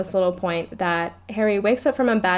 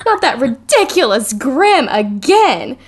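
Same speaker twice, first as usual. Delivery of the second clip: louder, slower, laughing through it; loud exasperation; 5 semitones higher, 7 dB louder, slower than usual. A narrator's reading. Reading Hermione snapping at Trelawney, loud and laughing. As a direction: burst out fed up with the Grim. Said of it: "says Hermione loudly".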